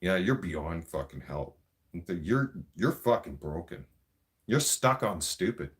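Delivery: ordinary talk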